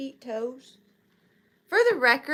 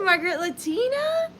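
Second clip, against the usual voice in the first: ordinary talk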